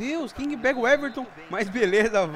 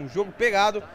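normal talking voice

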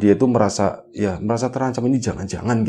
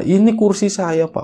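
urgent; 11 semitones higher, 5 dB louder than usual. Retold or imitated. Yogas points to his chair.

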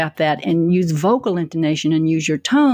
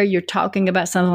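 ordinary talk, nothing marked

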